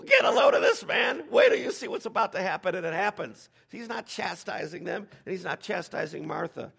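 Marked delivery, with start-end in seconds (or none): 0.0-2.7 high, loud shout of anticipation; giddy raised voice, easing off toward the end; excited boasting. Retold or imitated. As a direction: holler it like a friend before a big stunt. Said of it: "It's kinda like".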